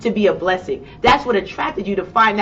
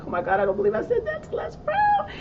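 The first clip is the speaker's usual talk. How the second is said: much higher, quivering mock panic; shaky disbelief; 4 dB quieter than usual. To herself after talking back to Les Brown, mock panic. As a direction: squeal out your nervous disbelief. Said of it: "I was inside shaking and shivering".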